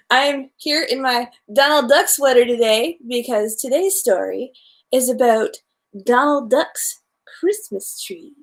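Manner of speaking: usual speaking voice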